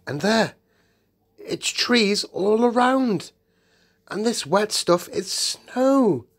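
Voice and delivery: high, loud, stretched words of wonder; acted amazement, easing toward the end; excited discovery. A narrator's reading. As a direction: marvel aloud at each new sight. Peter sees trees and snow.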